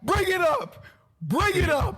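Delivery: loud, high shout. Real, not acted. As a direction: yell it out to hype the callout. Heated debate, urging a callout.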